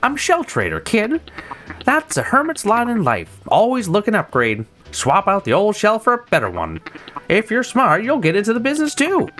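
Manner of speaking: a pushy hermit crab dealer rattling off his pitch; slick shell trader. Acted. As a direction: sell him the dream, smooth and quick.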